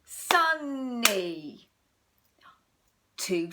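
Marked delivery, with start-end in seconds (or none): 0.1-1.7 SUN-ny in two clear beats, louder; clapped syllables. Shown as a demonstration. Teacher sounding out Sunny for children, in two beats. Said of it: "let's clap how many syllables".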